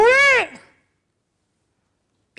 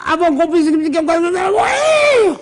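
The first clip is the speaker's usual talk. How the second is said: triumphant cry; 5 semitones higher than usual. Real, not acted. Teacher drives home Yehuda's argument.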